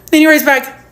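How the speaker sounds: usual speaking voice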